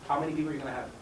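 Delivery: ordinary delivery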